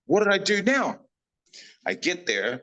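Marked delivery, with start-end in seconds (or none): 0.1-0.8 high, loud startled question; mock panic, much higher and louder; alarmed. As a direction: blurt it out in alarm. Retold alarm at being summoned.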